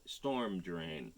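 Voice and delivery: ordinary delivery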